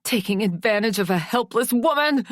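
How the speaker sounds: loud, high accusing shout; angry shout at the men. Acted. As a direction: shout it across the room. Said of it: "I shouted".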